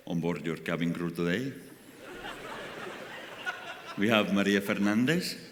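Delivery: hammed-up flight attendant reading the crew names; cabin crew announcement. Comedian imitates a Ryanair crew announcing the team, mock announcer.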